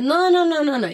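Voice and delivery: loud, high string of refusals; mock panicked refusal. Husband refusing his wife's lesson, retold high and loud.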